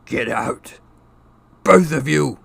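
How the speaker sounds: loud, croaked order. A narrator's reading. Shaken uncle ordering both boys out, loud croak. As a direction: force it out in a croak. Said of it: "croaked Uncle Vernon".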